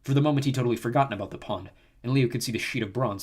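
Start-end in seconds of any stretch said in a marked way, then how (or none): none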